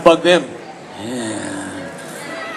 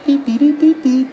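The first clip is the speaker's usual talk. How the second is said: mock beeping; 8 semitones higher than usual. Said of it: "They're like".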